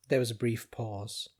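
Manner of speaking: plain reading voice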